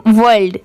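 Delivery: "वर्ल्ड" with an L before the D; L sounded. Shown as a demonstration. Teacher shows what adding L does, "वर्ल्ड" with clear L. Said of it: "सिर्फ एल add हो गया".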